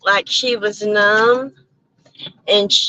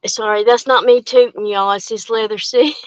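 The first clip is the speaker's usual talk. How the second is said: normal talking voice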